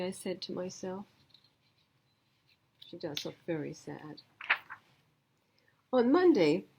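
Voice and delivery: ordinary delivery, nothing marked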